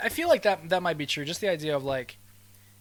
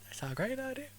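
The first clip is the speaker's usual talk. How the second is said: high mock voice; 10 semitones higher, 6 dB quieter than usual.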